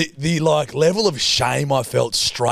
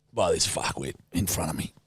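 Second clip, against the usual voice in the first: ordinary talk, nothing marked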